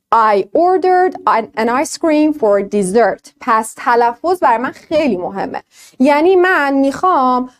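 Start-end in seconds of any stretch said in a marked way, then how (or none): 0.1-3.3 I had ice cream for dessert, each word spoken very slowly; very slow demo. Shown as a demonstration. Teacher slowly reads the example to the class.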